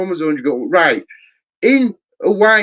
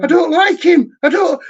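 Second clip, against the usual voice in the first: high mock whiny voice, much higher; mimicking a playing-victim woman; 12 semitones higher, 4 dB louder than usual. Mocking women who play the victim, high whiny voice. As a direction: whine it like a fake victim.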